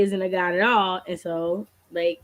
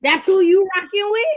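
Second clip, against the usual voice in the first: mock incredulous; 8 semitones higher, 6 dB louder than usual. Mock scolding a hypothetical reader.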